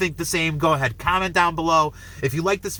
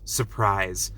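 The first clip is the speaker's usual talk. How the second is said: stone-faced; 8 semitones lower, 3 dB quieter than usual. Acted. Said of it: "stone face".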